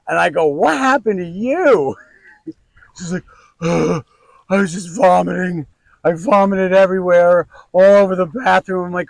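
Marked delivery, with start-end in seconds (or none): 0.5-2.0 slow, higher, louder disbelief; slow shocked question to his wife. Retold or imitated. Shocked at wife's state. 3.6-8.9 mock miserable wife. Wife voiced explaining vomiting.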